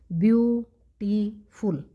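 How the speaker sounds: "Beautiful" slowly split as view, टी, फुल; syllable by syllable. Shown as a demonstration. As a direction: count out three separate beats on "beautiful", pausing between them. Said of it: "रुक रुक के बोलते हैं".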